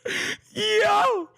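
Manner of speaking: very high, slow delighted squeal; real high-pitched glee. Real, not acted. Streamer delighted at the burn.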